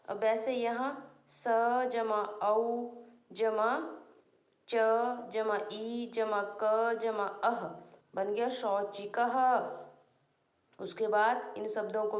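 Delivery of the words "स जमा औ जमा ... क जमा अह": slow spelling out. Shown as a demonstration.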